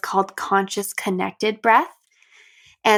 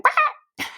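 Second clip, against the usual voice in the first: high squeaky quack, like a silly frog; comic high-pitched frog quack; frog quack imitation. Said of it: "and they went".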